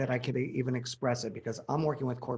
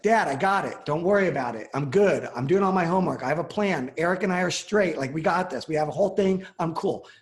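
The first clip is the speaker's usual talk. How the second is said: louder and higher, breezy self-assured daughter; confident; 5 semitones higher, 7 dB louder than usual. Retold or imitated. Daughter assuring her dad at dinner she has a plan, confident and upbeat. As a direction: reassure dad you've got it all handled. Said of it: "she said it in a way when you hear somebody with some confidence".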